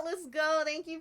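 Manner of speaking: ordinary talk, nothing marked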